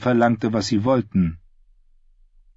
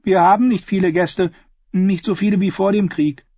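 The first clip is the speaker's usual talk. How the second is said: put-on higher girl's voice, businesslike; a girl on the porch sizing up strangers, higher and guarded; wary ten-year-old Willow; 11 semitones higher, 4 dB louder than usual. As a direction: sound like a watchful child running the inn. Willow explains.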